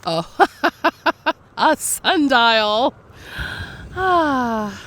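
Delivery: thrilled laughing discovery; excited. Real, not acted. Delighted find on the beach.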